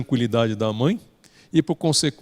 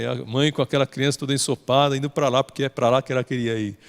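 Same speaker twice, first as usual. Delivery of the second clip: ordinary talk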